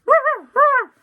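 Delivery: the annoying dog barking at the villain. Retold or imitated. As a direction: yap like an angry little dog. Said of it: "the dog's like".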